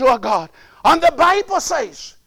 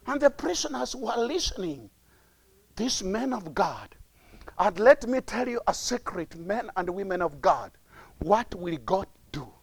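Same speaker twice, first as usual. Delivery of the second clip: low, hushed preaching; soft, deep, confidential tone; confiding; 7 semitones lower, 10 dB quieter than usual. Real, not acted. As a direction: drop right down as if sharing a secret.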